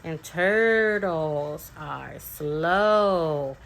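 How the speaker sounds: very slow, stretched-out words; turtle slow. Retold or imitated. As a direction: drag each word out playfully for a child. Acting out turtle slowness.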